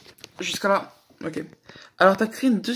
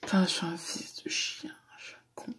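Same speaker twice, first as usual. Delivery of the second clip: soft unvoiced whisper, much softer; whispered aside; 10 dB quieter than usual. Real, not acted. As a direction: breathe it to yourself, ashamed. Self-reproach aside after a confession. (chuchoté, à voix basse pour soi)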